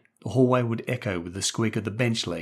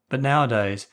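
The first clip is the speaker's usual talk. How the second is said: ordinary delivery, nothing marked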